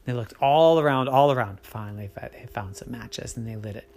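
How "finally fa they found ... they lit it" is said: much softer, hushed; hushed narration. A narrator's reading. Narrator tells finding the matches, much softer. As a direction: drop to a hush.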